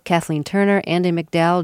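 normal talking voice

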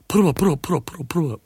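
high, yawny cartoon lion voice; rapid-fire blustering repeats, pitched well up; Bert Lahr's Cowardly Lion. Shown as a demonstration. Singer imitating a film lion's natural yawn, high yawning voice. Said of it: "he would talk like this".

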